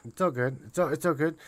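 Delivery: normal talking voice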